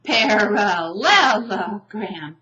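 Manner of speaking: "Parallelogram" loud and chunked, beat by beat; broken into syllables. Shown as a demonstration. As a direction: punch LEL in a drawn-out "Parallelogram". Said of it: "but it chunks it. It kind of breaks it down".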